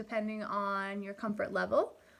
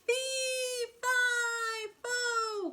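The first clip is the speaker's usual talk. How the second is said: high wailing cry, fading as he falls; a giant's panicked cry mid-fall; hammed-up falling giant yelling; 12 semitones higher, much slower than usual.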